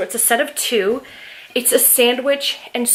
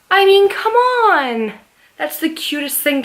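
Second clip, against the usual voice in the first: high, loud and drawn out; excited; 7 semitones higher, 4 dB louder, slower than usual. Real, not acted. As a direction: squeal it with delight. Gushing over Game of Thrones socks.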